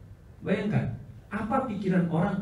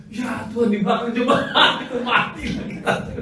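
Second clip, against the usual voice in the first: wife reading; 11 semitones higher, 6 dB louder than usual. Retold or imitated. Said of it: "istri saya baca".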